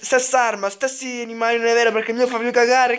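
usual speaking voice

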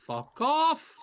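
high, loud furious yell; angry yell. Real, not acted. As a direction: scream it at the game. Escalated outburst, second take.

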